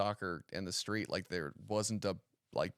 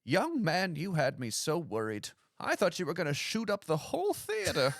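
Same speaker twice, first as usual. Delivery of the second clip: put-on high-pitched old lady voice; hammed-up old woman; 7 semitones higher than usual. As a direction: play a relieved elderly woman. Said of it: "this old woman puts her hand on my shoulder and goes".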